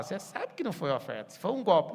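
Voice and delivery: loud, rising retort; brain scolding. Retold or imitated. The listener's own brain scolding them, loud and rising. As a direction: let the inner voice call out the scam. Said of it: "seu cérebro fala".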